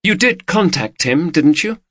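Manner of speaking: lightly voiced worried wife; woman's voice. A narrator's reading. Female character voice.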